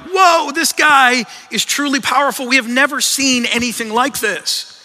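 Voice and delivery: high-pitched, amazed exclamation; acted-out overwhelmed astonishment; awestruck terror. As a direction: burst out high and loud in awe. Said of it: "of just terror".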